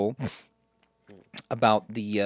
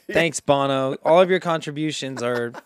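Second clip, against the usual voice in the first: usual speaking voice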